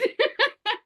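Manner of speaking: laughter leaping very high. Real, not acted. Mother amused by her son's reply, high laughing. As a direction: let a high laugh burst out.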